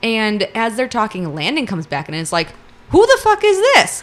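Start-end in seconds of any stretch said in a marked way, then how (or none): 2.9-4.0 high, loud, mock-outraged yell; indignant outburst. Landon finds a stranger in the room, indignant. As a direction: burst out in high-pitched disbelief.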